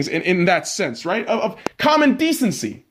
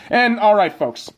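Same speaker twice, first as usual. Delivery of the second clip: ordinary talk, nothing marked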